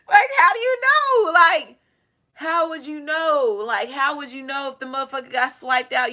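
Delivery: high, half-laughing disbelief; incredulous. Real, not acted. Amazed at the movie's twist.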